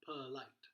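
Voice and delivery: polite as puh-LITE with a schwa; quick short U. Shown as a demonstration. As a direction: reduce the first vowel of polite to uh. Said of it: "sounds like a very quick short U".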